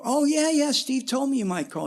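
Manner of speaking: imitating his own keen younger self; eager reply. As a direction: jump on the call with excitement. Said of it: "I go".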